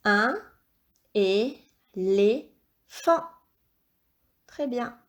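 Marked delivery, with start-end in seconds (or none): 0.1-2.5 slow reading aloud. Shown as a demonstration. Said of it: "À toi de lire le mot".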